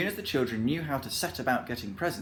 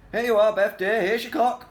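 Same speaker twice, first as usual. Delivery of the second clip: high, loud, proud offering; beaming proudly; 10 semitones higher, 9 dB louder than usual. A narrator's reading. Saucepan handing Beth the clock, loud proud beaming. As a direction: present it with loud delight. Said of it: "beaming at her".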